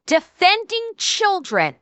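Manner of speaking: ordinary talk, nothing marked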